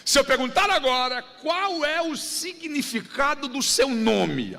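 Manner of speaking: usual speaking voice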